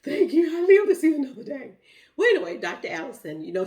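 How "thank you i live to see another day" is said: high, loud burst of relief; gasping thanks at finally breathing; relieved thanks. Retold or imitated. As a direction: cry out thanks to God, much higher and louder. Breath returns, thanking God.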